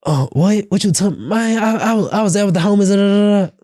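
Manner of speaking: put-on a boyfriend caught out late gets defensive and high-pitched; defensive man. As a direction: pitch it up and get defensive, like he's been caught. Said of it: "I still might get defensive".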